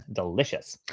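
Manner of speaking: plain reading voice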